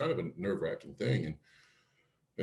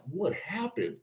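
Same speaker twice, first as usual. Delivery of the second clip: high-pitched retold bewilderment; alarmed question; 11 semitones higher, slower than usual. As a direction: ask it way up high, demanding news.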